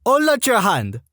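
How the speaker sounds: high French-Canadian sergeant; stern order in French-Canadian accent. Retold or imitated. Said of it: "female French-Canadian sergeant with this accent".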